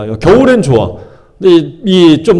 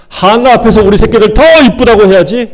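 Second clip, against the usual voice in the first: spiteful gloating; 6 semitones higher, 4 dB louder than usual. Retold or imitated. Peninnah scheming to flaunt children.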